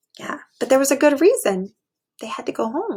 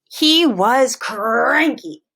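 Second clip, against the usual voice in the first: very slow, stretched reading; drawn-out cranky; 4 dB louder, much slower than usual. A narrator's reading. Teacher reading to kids, dragging out a cranky mood. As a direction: let cranky drag.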